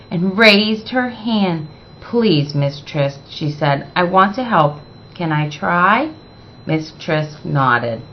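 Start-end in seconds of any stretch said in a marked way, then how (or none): 2.0-6.1 softer, gentle request; polite quiet plea. Acted. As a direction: ask timidly for a chance. Zoe asks gently, politely.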